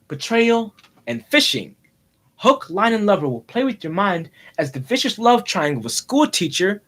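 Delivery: dramatic trailer voiceover with weighty pauses; movie trailer announcer. Pretend. Boy announcing his imagined film, mock trailer voice. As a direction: sell it like a summer blockbuster.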